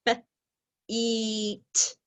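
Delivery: drawn-out sound by sound; stretched out. Shown as a demonstration. Teacher stretching the word for students, drawn out. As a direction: stretch each sound long. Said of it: "Stretch it with me".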